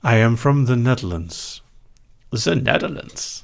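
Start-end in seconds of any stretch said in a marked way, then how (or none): 2.3-3.4 higher, hammed-up Dutch accent; mock Dutch accent, pitched up; put-on Dutch accent. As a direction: say it again with a thick Dutch accent. Repeats home country in put-on accent.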